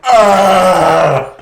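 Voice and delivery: loud, high drawn-out "ah"; imitated wild cry. Imitating the lumberjack ripping off clothes, loud cry. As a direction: let out a long mock roar.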